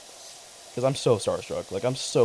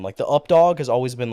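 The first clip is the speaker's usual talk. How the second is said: usual speaking voice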